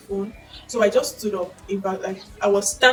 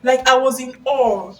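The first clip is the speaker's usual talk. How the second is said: usual speaking voice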